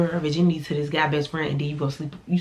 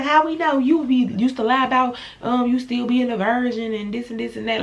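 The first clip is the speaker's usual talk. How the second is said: mimicked sister; 6 semitones higher than usual. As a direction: fire her comeback back up high.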